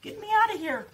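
high, loud cry for help; yelling for help. A narrator's reading. Littlest dinosaur stuck in mud, yelling for help. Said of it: "having to yell".

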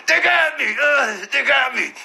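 high, pained little "They got me."; mock wounded whimper. Retelling a bloodied guy, high mock whimper. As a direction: imitate a beaten man moaning "They got me". Imitating the beaten man.

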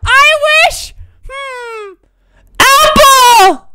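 very high-pitched, loud mimicry; mock wisher. Genie player imitating the last guy's apple wish, mock high voice. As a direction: put on a high little voice, land loudly on the last word.

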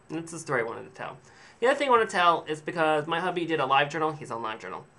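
ordinary talk, nothing marked

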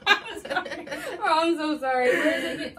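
loud, laughing apology. Real, not acted. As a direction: apologize but can't stop laughing.

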